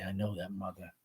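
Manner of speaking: very soft and low, tender; gently. Acted. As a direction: say it hushed and kind. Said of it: "Tom, gently".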